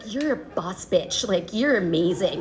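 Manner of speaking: low, slow pep talk to herself; self pep talk. Acted. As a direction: talk yourself up, low and drawn out.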